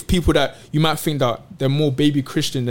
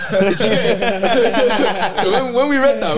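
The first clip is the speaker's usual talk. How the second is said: talking through a laugh, pitched up; 6 semitones higher, slower than usual. Real, not acted. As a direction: chuckle out the words, still amused by the joke. Laughing while recalling the joke.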